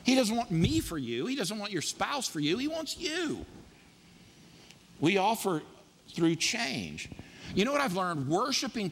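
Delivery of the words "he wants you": high, softer, drawn-out you; emphatic you. Real, not acted. Pastor telling the church he wants you, high soft emphasis. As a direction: land on you like a gentle plea.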